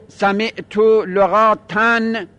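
لغاتن drawn out slowly, ending in "-tan"; accusative -an ending. Shown as a demonstration. Teacher showing the accusative case, slow -tan on لغاتن. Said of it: "این باید بگیم".

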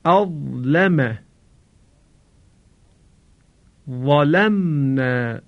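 each word slow and separate with a heavy emphatic ظ; model Arabic ẓāʼ. Shown as a demonstration. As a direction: hold «ظلال» and «عظاما» long with a thick, full ظ. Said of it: "به تلفظ وا در این کلمات گوش فرا دهید".

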